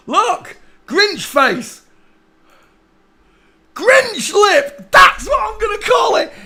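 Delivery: high, loud excited shout. Real, not acted. Vlogger shouts out his new Grinch nickname, loud and thrilled.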